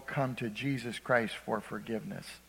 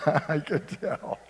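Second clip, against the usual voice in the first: slow, laughing through the words; slower than usual. Real, not acted. Preacher teasing a listener who dislikes peas, laughing. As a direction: chuckle it out.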